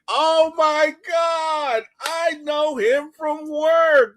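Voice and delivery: very high, loud, slow joyful shout; excited shout. Retold or imitated. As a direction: yell out in delighted surprise. Thor shouting at seeing Hulk.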